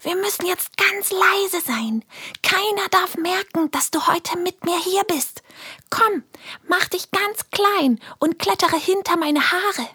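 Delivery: put-on hushed little girl. Helene whispers to hide friend. (höhere, halb geflüsterte Mädchenstimme)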